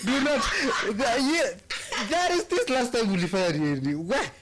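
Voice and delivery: high laughing protest; laughing hard. Real, not acted. Reacting to cohost's jab at her mum, laughing. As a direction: laugh through the words, real amusement.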